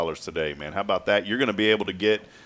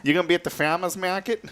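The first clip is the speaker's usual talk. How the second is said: high-pitched imitation Connecticut accent; 10 semitones higher than usual. Host mimics dad's friend from Connecticut, in accent. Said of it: "It's like a Connecticut accent".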